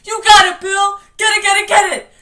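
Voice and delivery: high, loud, repeated frantic cheering; delirious scream. Acted. Richie cheering Bill on, screaming deliriously. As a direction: egg him on at full volume. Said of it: "Richie screamed deliriously".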